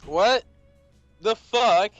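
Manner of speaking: ordinary delivery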